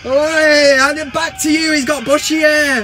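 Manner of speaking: high, loud mock heckling; hammed-up terrace argument; bickering football fans. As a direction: holler it back like a rival supporter. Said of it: "In between the Man United fans".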